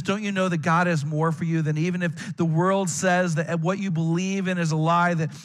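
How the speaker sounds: ordinary talk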